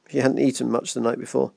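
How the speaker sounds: ordinary delivery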